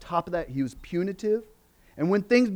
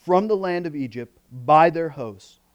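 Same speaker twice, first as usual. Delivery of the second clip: scripture reading; 5 semitones lower than usual. Acted. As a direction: read the text with solemn care. Reads scripture before the sermon.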